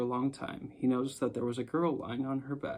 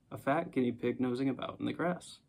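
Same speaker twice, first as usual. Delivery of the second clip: ordinary delivery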